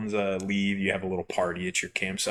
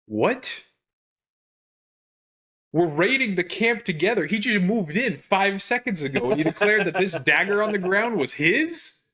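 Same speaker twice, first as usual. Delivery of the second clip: much higher, indignant mock outrage; outraged disbelief; 10 semitones higher, 4 dB louder than usual. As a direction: squeak out the injustice, bewildered. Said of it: "And I'm like".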